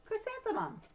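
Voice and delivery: name jumps up high in surprise; puzzled roll call. Acted. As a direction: stumble on a strange name. Teacher reading odd name.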